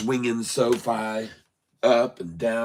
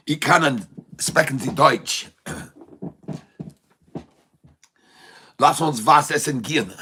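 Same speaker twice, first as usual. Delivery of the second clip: hammed-up German. Channeling German to stream viewers, hammed-up mock German. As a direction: ham up German phrases for fun. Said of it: "So I speak in the German now".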